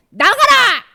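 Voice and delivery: 아주 높고 크게 명령; a high, very loud command; commanding shout. Acted. 귀신을 쫓아냄. (아주 높고 크게 외치는 명령)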